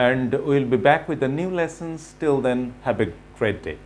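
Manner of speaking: ordinary talk, nothing marked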